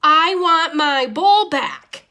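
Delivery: a fed-up fish screaming at his tankmates. Acted. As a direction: scream out that the bowl is yours. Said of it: "I screamed".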